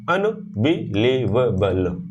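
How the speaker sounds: "अनबिलीवेबल" slowly, with short breaks between syllables; broken into syllables. Shown as a demonstration. Teacher shows how to manage hard words, "अनबिलीवेबल" broken up. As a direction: separate each syllable of "अनबिलीवेबल". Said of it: "बीच-बीच में break करते हो".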